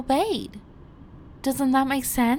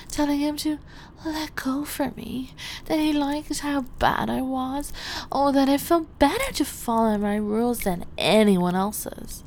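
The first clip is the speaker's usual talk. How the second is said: usual speaking voice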